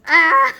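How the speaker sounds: a sudden loud yelp of terror at the dogs; panicked scream. Retold or imitated. Teller screams on hearing the Dobermans, loud panicked yelp. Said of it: "So I'm like".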